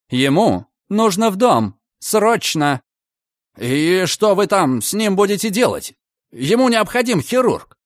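heated argument. A narrator's reading. Heated argument over the patient.

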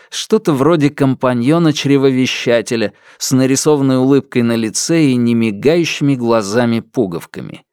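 ordinary delivery

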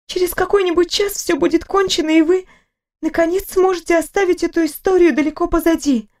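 a fussing nurse who cannot stop talking; chatty nurse. Acted.

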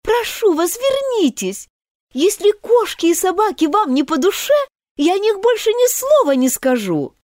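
high, urgent plea; girl pleading earnestly to the mouse. Acted. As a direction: beg her to come back. Alice pleading.

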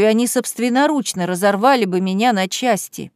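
ordinary delivery

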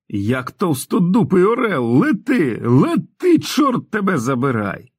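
high, drawn-out frenzied yell; bawling. A narrator's reading. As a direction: urge the car on, yelling wildly. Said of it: "горлав пацан".

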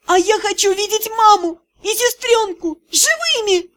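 high desperate cries; girl cries out wanting her family alive. A narrator's reading. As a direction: plead and shout at once.

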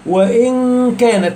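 "وإن كان" with the n drawn out toward the k; hidden noun, ikhfa. Shown as a demonstration. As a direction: let the nasal hum in "وإن كان" slide into the k. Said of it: "تحس النون بتتسحب لحد".

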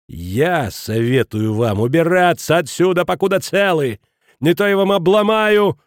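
slow start climbing to a loud high shout; threatening. A narrator's reading. Kraner grabbing the headmaster by the lapels, threatening.

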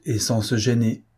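ordinary delivery, nothing marked

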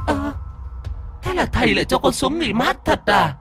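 a sly ghost squinting and questioning; squeaky ghost voice. A narrator's reading. Possessing ghost asking the master why it must go down.

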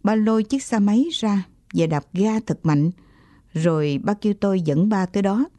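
ordinary delivery, nothing marked

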